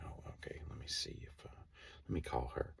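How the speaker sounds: whispered, under her breath; muttering to herself. Retold or imitated. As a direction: whisper it to yourself. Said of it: "she's kinda talking to herself".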